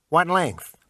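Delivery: high, slow salesman pitch; pawn shop salesman. Acted. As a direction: sell it like a pushy clerk. Shopkeeper haggling.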